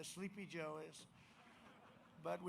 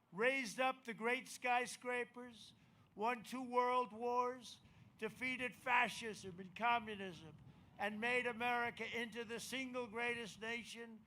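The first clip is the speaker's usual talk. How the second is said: soaring patriotic rally climax; rally speech; 6 semitones higher, 4 dB louder than usual. Acted.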